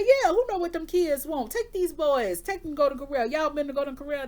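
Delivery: high, loud imitation of grandma; insistent grandma. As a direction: push the plan like a bossy grandma. Voicing grandma insisting on restaurant.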